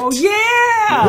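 loud, high, thrilled yell; excited shout. Real, not acted. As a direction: shout it with glee. Excited reaction to plot twist.

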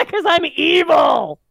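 high, loud, drawn-out EVIL; hammed-up villainous cry through laughter; gleefully evil shout. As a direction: shout it slowly like a playful villain. Gleeful confession amid laughter.

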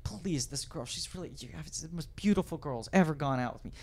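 quiet begging. Retold or imitated. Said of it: "He goes".

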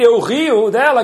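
normal talking voice

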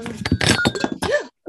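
high, sharp startled gasp. Real, not acted. Co-host spills tea while shuffling, startled gasp. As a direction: gasp as if something just spilled.

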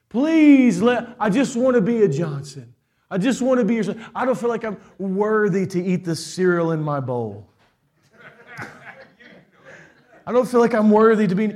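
put-on a child pleading to be let into the family, half laughing; begging child. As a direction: whine it out like a kid begging his dad for a place. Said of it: "be like".

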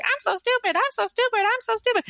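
silly high cartoon mouse voice; Mickey Mouse voice. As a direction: squeak it out like Mickey Mouse with a finger up the nose. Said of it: "with a Mickey Mouse voice".

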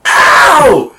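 high, loud mock ow; retold yelp of pain. Kid hit by a falling scale, yelping ow. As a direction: belt out the ow like a hurt kid. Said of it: "I walked out like".

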